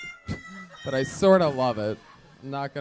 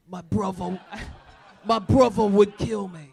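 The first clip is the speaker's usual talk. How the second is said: Cockney mimicry with a V in "brother"; higher, louder British echo, saying "bruvver"; mock British accent; 5 semitones higher, 5 dB louder than usual. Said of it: "You guys throw a V in brother".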